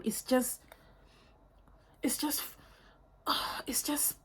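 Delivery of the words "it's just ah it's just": breathless awed whisper. Real, not acted. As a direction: whisper it, overwhelmed by beauty. Lost for words over scent.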